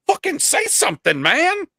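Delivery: playfully outraged shout; mock-angry demand. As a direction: badger the lurker, voice shooting up. Calls out silent chat lurker.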